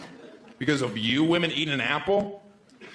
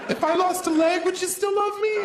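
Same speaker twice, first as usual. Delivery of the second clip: much higher, pleading mock girlfriend. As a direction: ask it in a squeaky high girly voice. Mocking girlfriend asking.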